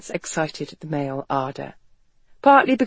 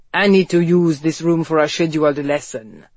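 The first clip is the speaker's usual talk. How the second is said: stern French fencing master; curt, irritated instructor; 3 semitones lower, 3 dB louder than usual. A narrator's reading. Fencing master voiced in story.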